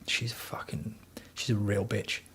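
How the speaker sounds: hushed, voiceless whisper, as if she might hear; whispered aside. Real, not acted. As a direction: lean in and whisper it so she can't catch it. Joking about the woman at home.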